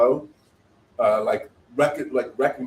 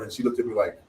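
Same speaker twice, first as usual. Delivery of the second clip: normal talking voice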